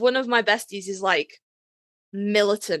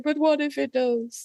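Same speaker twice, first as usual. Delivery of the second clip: anxious what-if; 4 semitones higher, slower than usual. Retold or imitated. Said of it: "but I'm just like".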